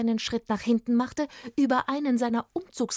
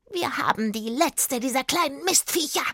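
high, croaky witch voice; raspy, gloating hag; smoky croaking witch; 6 semitones higher than usual. A narrator's reading. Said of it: "mit ihrer rauchig krächzenden Stimme".

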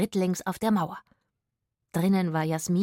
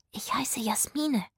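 slow, hushed whisper; 5 semitones higher, 4 dB quieter, slower than usual. A narrator's reading. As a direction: introduce yourself in a secret whisper. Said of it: "flüsterte sie".

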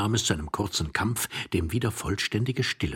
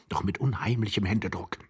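ordinary delivery, nothing marked

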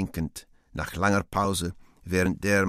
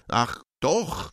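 piqued; 7 semitones higher, 4 dB louder than usual. Acted. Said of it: "Teufel pikiert".

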